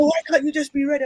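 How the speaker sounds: high, fast mock nagging; exasperated daughter. Daughter chivvying mum who isn't ready, exasperated. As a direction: imitate a daughter losing patience with mum. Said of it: "Obviously, I have adult children now".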